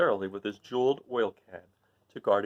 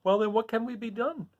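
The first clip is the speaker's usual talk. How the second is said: high, puzzled Tin Woodman; a worried tin man asking; 11 semitones higher, 5 dB quieter than usual. Acted. Tin Woodman asking what to do. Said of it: "inquired the Woodman".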